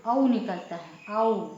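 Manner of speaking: औ drawn out with an open mouth; wide au. Shown as a demonstration. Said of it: "थोड़ा बड़ा उच्चारण निकलता है". (बड़ा औ, मुंह खोलकर)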